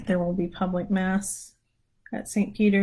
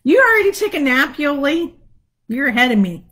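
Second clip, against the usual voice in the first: playful teasing; 6 semitones higher, 8 dB louder, faster than usual. Real, not acted. Teasing a viewer about napping.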